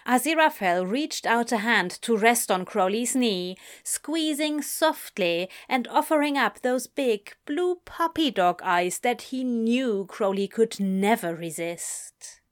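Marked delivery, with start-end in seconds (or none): none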